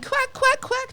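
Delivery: high mock duck quacks. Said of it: "I was saying".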